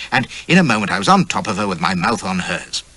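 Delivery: ordinary delivery, nothing marked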